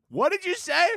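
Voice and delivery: high startled squeak; a hammed-up gasped question; mock shock. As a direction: jolt up high as if scandalized. Startled reaction to own words.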